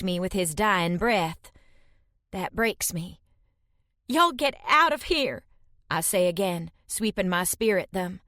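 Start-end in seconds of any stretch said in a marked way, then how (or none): 4.1-5.4 high, pleading yell; desperate shout. Acted. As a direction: chase them off desperately. Breaking, driving them away.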